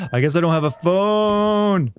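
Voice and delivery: high, slow yell with a long held last word; calling out. Pretend. Player still yelling into the game, high drawn-out call. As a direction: holler it slowly, stretching the end.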